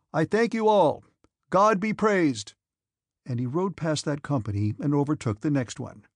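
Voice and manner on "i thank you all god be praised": higher, louder and slow, proclaiming; loud proclamation. A narrator's reading. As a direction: proclaim it slowly to the whole regiment. General addressing soldiers aloud.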